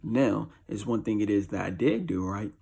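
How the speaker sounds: ordinary delivery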